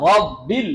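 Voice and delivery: রব্বিল with a thick, heavy r; রব্বিল said with a full, dark r. Shown as a demonstration. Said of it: "র মোটা করে".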